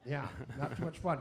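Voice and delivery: ordinary talk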